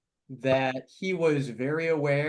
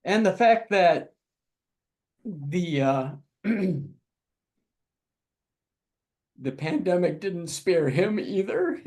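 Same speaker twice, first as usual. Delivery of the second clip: higher, halting voice with long pauses and throat-clearing; choked up; 4 semitones higher than usual. Real, not acted. Panelist remembering a friend lost in the epidemic, choked up. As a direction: push through real emotion, stopping to recover. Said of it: "I'm getting choked up".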